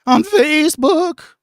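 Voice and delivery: put-on high squeaky voice-breaking teen; mock a boy whose voice is breaking; pubescent son voice. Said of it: "Sound like my fucking little pubescent son".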